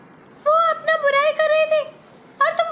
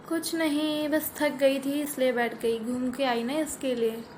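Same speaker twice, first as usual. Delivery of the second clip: much lower, slow, weary elder voice; tired elder sister; 13 semitones lower, 9 dB quieter, slower than usual. Acted. Elder sister explaining why she sat down, low and tired. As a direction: sink into a worn-out older sister.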